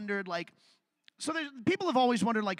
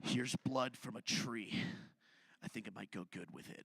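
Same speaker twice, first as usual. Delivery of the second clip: hushed aside; 10 semitones lower, 9 dB quieter than usual. Acted. Caveman quietly offering tree syrup with pancakes. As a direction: murmur it like a proud secret.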